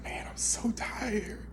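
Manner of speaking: drawn-out, tearful whisper; weary child self. Retold or imitated. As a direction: whisper it slowly, utterly worn out. Voices his weary child self.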